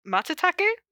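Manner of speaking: puzzled rising Matsutake; checking how to say Matsutake; unsure of the word. Real, not acted. Reader unsure of the word, puzzled.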